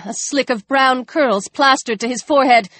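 ordinary delivery

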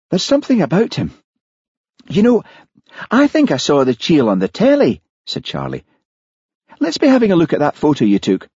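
put-on high, anxious young Scots voice; Charlie, nervous Highland constable. A narrator's reading. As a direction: sound spooked and uneasy.

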